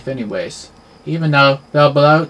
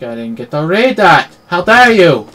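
loud indignant outburst; outraged; 3 semitones higher, 9 dB louder than usual. Real, not acted. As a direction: yell it in mock outrage. Outraged at missing the text.

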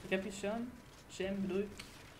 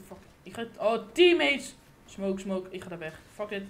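ordinary talk

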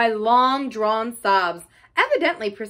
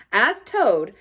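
ordinary delivery

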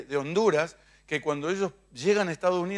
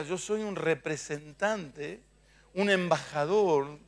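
said slowly, stressing soy in representante; slow declaration; 4 dB quieter, slower than usual. Shown as a demonstration. Congregation repeats after the preacher, slow and firm. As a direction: proclaim it slowly, like a title.

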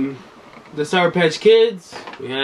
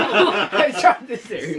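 laughing through the words; giggling while saying it; 6 semitones higher than usual. Real, not acted. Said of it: "laughing while speaking".

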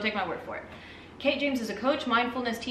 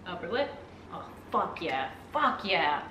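ordinary delivery

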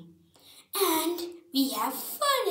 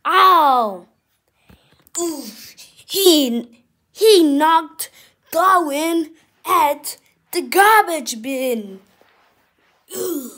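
excited commentator; 6 semitones higher, 15 dB louder than usual. Acted. Loud fight announcing.

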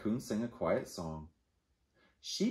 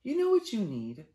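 sheep voice; 11 semitones higher than usual. Acted. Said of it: "said Sheep".

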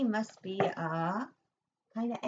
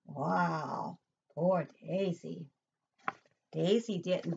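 plain reading voice

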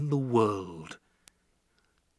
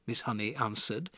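ordinary delivery, nothing marked

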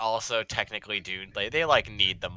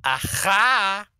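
high triumphant aha; 11 semitones higher, 8 dB louder than usual. Pretend. Triumphant put-on exclamation.

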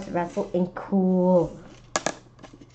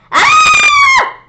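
loud high shriek of terror; screamed; 12 dB louder than usual. A narrator's reading. Kids shrieking at the Halloween Monster, terrified scream. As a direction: scream in fright. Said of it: "We all screamed".

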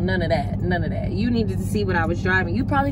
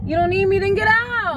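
angry shout; 10 semitones higher than usual. Acted. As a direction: kick him out, yelling. Argument, throwing him out of car.

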